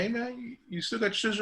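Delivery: a relative on the phone, pitched well up; a high, friendly ask for a favor; voiced relative. Relative calling to ask for a haircut, high voiced.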